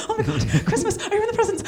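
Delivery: put-on high-pitched childlike Christmas-morning excitement; excited. Host re-enacting being thrilled on stage, giddily excited. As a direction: gush it high with laughter bubbling through. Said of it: "So I was literally like ... so excited".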